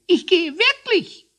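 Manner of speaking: very high, loud squeak; squawking hare. A narrator's reading. Hare warns he is really leaving, squawking. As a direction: squawk it as a threat. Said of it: "Der Hase quäkte".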